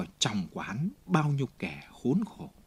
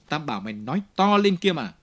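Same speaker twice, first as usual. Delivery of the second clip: a louder, fast, angry order; angry bark; 4 semitones higher, 7 dB louder, faster than usual. A narrator's reading. Javert yelling at Jean to speak up, loud and fast. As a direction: force him to speak up.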